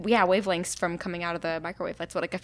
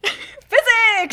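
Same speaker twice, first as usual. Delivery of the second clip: excited exclaim; 9 dB louder than usual. Real, not acted.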